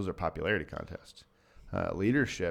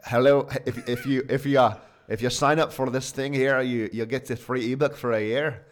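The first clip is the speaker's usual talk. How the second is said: higher, louder Scottish impression of a conference guy; imitated Scottish accent; 5 semitones higher, 5 dB louder than usual. Retelling the Scottish guy at the conference, Scottish accent. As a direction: put on a hammy Scottish accent. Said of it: "tried to do the Scottish accent".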